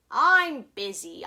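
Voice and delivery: loud snap of a brush-off; loud impatient Onceler; curt brush-off. A narrator's reading. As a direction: bark it out impatiently. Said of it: "I told him".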